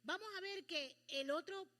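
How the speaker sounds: usual speaking voice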